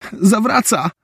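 high warning shout. A narrator's reading. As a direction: call out that it's coming back. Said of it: "krzyknął Takoda". (wysoki okrzyk ostrzeżenia)

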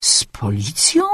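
slow, low, hushed echo in disbelief; astonished, whispery query; surprised question. A narrator's reading. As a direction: repeat it slowly, half whispered, rising in surprise. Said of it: "Zdziwiłam się".

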